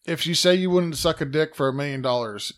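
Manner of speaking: normal talking voice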